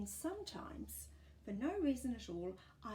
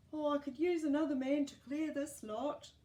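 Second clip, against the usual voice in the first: higher, louder put-on old gardener; a friendly old man sighing at the work; old Fred, weary; 7 semitones higher, 8 dB louder than usual. Old Fred leaning on shovel.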